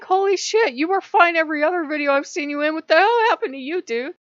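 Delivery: high, loud, rushed mock shock; incredulous. Viewer baffled by a changed video host, incredulous. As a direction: blurt it out in exaggerated disbelief. Acted reaction to the strange delivery.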